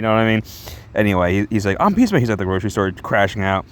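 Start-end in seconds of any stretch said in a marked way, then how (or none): 1.8-2.2 high, louder proud announcement; heroic intro. Retold or imitated. Peacemaker introducing himself at the grocery store, proudly. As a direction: boom out your own name. Reading hero's self-introduction.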